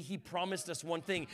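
normal talking voice

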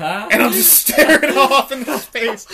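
laughing through the words; breaking into laughter mid-line; giggling high while telling it. Real, not acted.

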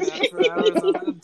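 warped, robotic alien filter; alien voice changer. Acted. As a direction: talk through a sci-fi voice changer. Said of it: "this voice changer has fifteen different voices".

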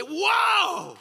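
high, wide-eyed wow; amazed wow. Pretend. Husband seeing his wife's face, a high delighted wow. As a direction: gasp it out in delight.

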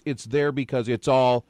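ordinary delivery

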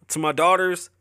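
ordinary talk

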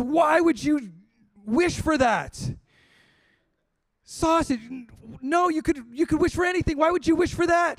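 wife shrieking at her foolish husband; exasperated wife. Acted. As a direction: scold him for a wasted wish. Wife berates husband over sausage.

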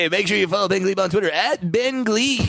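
upbeat radio DJ plug; morning DJ voice. Pretend. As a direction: sell it like a morning radio host. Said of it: "morning D J voice".

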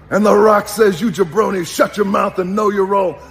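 high, booming wrestling promo voice; hammed-up catchphrase delivery; The Rock. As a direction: taunt an opponent in a wrestler's promo. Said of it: "when I became The Rock".

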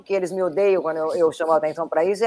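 normal talking voice

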